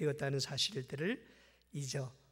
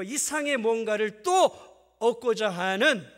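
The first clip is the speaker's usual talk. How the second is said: forceful preaching; 5 semitones higher, 10 dB louder than usual. Acted. Preacher rising to full force.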